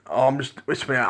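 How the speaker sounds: normal talking voice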